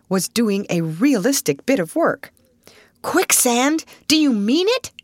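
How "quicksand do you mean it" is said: high-pitched, startled question; alarmed gasp. A narrator's reading. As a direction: gasp it in shocked disbelief. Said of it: "gasped Mister Pertell".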